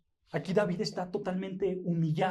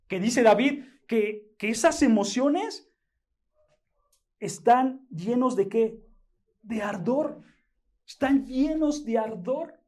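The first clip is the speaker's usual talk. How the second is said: climbing higher and louder as the preaching builds; rising preaching; 4 semitones higher, 4 dB louder than usual. Acted. Preacher stirring his congregation, rising fervent preaching. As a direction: build the sermon upward with fervour.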